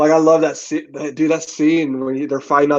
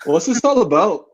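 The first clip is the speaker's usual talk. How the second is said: put-on joking, higher-pitched Canadian accent; mock Canadian Wolverine accent; 5 semitones higher than usual. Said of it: "the most obscure Canadian accent".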